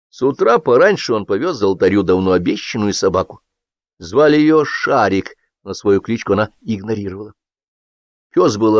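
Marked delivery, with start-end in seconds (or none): none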